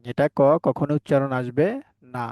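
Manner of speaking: usual speaking voice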